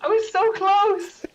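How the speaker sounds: very high, slow and loud lament; anguished near-miss wail. Real, not acted. Contestant who just missed the answer, exasperated wail. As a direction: wail it, drawing out the words. Lost by one letter.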